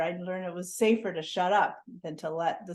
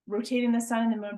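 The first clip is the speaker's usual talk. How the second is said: normal talking voice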